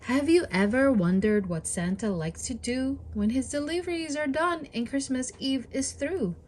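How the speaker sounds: plain reading voice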